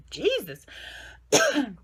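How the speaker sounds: strained gasp between coughing fits; choking on a cough. Real, not acted. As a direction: fight through a real coughing fit. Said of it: "gonna choke to death".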